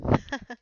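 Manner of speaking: quick embarrassed laugh; laughing at own accent. Real, not acted. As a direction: burst of loud breathy laughter. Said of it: "mon accent".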